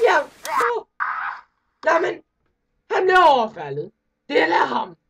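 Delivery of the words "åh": high wincing cry; real wincing outcry; pained cry. Real, not acted. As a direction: cry out in sympathy. Pained reaction to the video.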